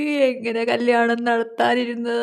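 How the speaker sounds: wailing. Acted. Mother lamenting the wedding she had planned, wailing. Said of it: "കരയാണ്ടിരിക്കും".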